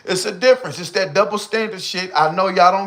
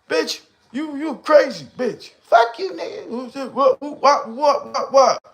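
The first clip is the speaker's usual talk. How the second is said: much higher, laughing mock quarrel; mock arguing couple; 10 semitones higher than usual. Imagined couple arguing yesterday, high laughing mock insults. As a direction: yell and laugh through a fake fight.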